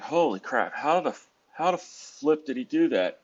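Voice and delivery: retold astonishment, pitch jumping up; amazed reaction. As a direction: act out baffled admiration. Retelling amazement at skillful driving.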